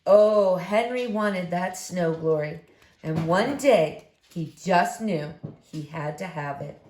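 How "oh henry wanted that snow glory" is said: usual speaking voice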